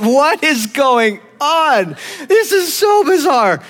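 high, loud, drawn-out comic disbelief; retold bewilderment. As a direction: cry out in exaggerated confusion. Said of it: "So I'm, like, listening to this".

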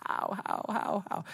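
whispered panic, frantic repeating; mock panicked whisper. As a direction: breathe the words out in a half-hearted panic. Freaking out in the darkness.

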